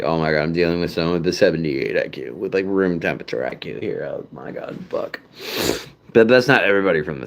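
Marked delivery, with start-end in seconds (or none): 0.0-5.2 exasperated inner aside. Retold or imitated. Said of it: "I'm just like".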